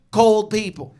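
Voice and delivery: ordinary talk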